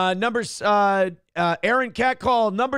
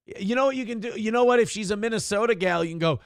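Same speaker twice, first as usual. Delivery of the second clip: normal talking voice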